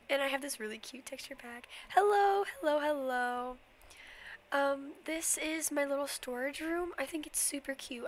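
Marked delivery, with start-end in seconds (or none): 1.9-3.6 excited calling out. Real, not acted. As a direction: call out a cheerful hello. Calling out greeting.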